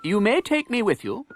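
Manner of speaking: put-on high, lightly regal boy's voice; young king. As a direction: tell Miles his royal plans. Edward's voice.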